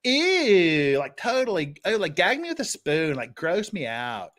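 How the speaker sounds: hammed-up Valley Girl "ew". Shown as a demonstration. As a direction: show them a grossed-out Valley Girl. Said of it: "say that in a Valley Girl voice".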